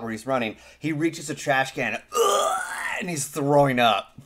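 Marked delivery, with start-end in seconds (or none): 2.1-2.6 sudden high retching ugh; retching noise. Retold or imitated. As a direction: gag like you're about to vomit. The man throws up in ad.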